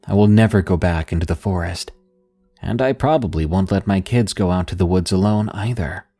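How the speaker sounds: ordinary delivery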